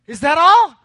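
hammed-up drive-thru interruption; impatient order-taker. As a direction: blare the question over the customer. Drive-thru lady interrupts again.

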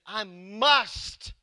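MUST pushed out loud and drawn; all-caps emphasis. Acted. Pastor quoting Jesus' statement, emphatic. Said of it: "I think that's how he said it".

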